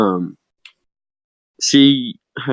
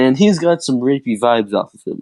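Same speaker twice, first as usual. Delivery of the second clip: usual speaking voice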